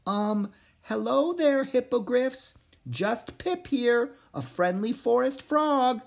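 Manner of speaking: high, slow, careful frog voice; gentle call. Acted. Pip greeting the hippogriffs, calling out gently. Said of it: "called out gently".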